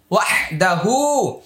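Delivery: ordinary delivery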